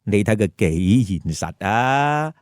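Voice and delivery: ordinary delivery, nothing marked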